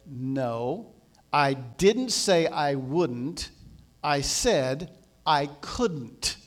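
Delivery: slow, measured, leaning on "wouldn't" and "couldn't"; low and deliberate, each word set apart; very emphatic. Retold or imitated. Jesus correcting the believer's question, very emphatic. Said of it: "very emphatically, he said".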